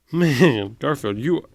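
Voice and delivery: ordinary delivery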